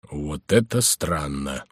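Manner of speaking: low, slow, softened aside; murmured puzzlement. A narrator's reading. Baffled prior to himself, in a hushed low voice. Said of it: "прошептал Горанфло".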